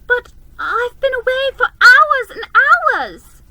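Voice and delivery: put-on high, loud protesting child; a little girl protesting she was gone for hours; high insistent little girl. A narrator's reading. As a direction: insist earnestly. Lucy insists.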